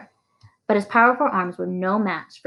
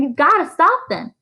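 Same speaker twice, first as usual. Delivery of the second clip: urgent plea; 10 semitones higher, 4 dB louder than usual. A narrator's reading. Teacher begging the sheriff.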